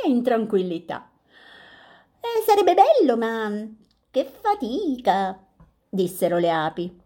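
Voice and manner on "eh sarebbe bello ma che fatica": little bees grumbling about the work; buzzing bees. Acted. Bees reply.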